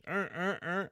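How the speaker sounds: short high honks mimicking a car horn; imitated car horn. Storyteller mimics drivers honking at him, imitated horn blasts. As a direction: honk like passing cars. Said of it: "Why are they honking at me?".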